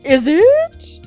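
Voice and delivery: loud, high, drawn-out question; demanding question. Acted. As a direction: swoop up loudly in exaggerated inquiry. Acted character demanding an answer.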